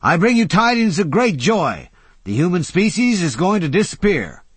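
high, loud mock-prophet shout; an old man shouting down the stairs to arriving guests; shouted proclamation. A narrator's reading. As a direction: announce doom like a herald, booming. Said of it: "Old Jay shouted".

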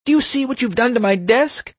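furious principal in a very high voice; enraged shriek. A narrator's reading. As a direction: screech in outrage at the culprit. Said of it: "the principal shrieked".

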